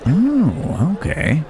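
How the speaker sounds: high, swooping "oh" of curiosity; intrigued surprise. Acted. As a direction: react with piqued interest, pitched high. Reacts to unfamiliar food offered.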